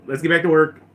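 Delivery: rally the crew, lightly acted; mock brisk command. Admiral gets crew working, loud and brisk. Piett resumes command after confusion.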